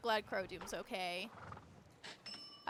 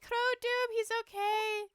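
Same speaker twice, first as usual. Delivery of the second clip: much higher and louder, bursting with relief; overjoyed relief; 12 semitones higher, 8 dB louder than usual. Acted. Rider rejoicing to her healed horse, high joyful cry. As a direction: cry out in delight that he's safe.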